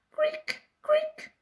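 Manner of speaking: high creaky imitation; wheel creak. A narrator's reading. The hamster's wheel creaking in the night, a high creak. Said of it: "the hamster's wheel went creak".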